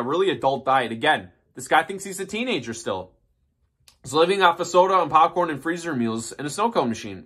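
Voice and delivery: normal talking voice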